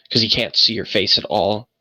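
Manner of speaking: ordinary talk, nothing marked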